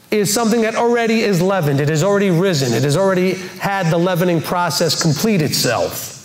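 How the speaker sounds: normal talking voice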